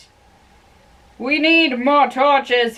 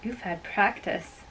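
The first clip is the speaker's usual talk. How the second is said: hushed tender; 3 semitones lower, 8 dB quieter than usual. Acted. Lovers murmuring over a kiss.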